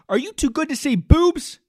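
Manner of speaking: ordinary delivery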